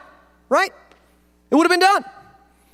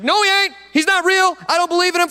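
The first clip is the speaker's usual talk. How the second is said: higher, defiant denial; defiant Thomas; 9 semitones higher than usual. Retold or imitated. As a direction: fling it back, stubborn. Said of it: "Thomas is like".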